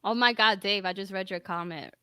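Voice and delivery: ordinary delivery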